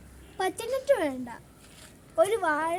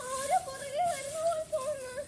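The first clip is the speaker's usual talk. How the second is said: uneasy; 9 semitones higher, 6 dB quieter than usual. Acted. Child senses someone following them.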